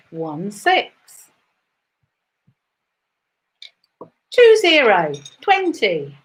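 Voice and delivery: rising bingo number call; bingo caller. Acted.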